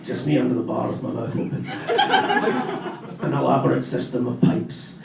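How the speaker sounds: put-on low, slow, mumbled Glasgow drunk; vague Glasgow accent. Drunk confessing at AA in a vague Glasgow accent. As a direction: slur it like a Glaswegian boozer. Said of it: "vaguely Glasgow accent for that".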